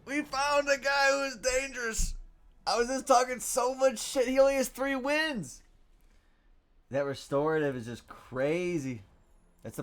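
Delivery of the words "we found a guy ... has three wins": high, loud cries of alarm; panicked shouting. Real, not acted. Streamer telling the boys we lost, panicked shouting. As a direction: wail that all is lost.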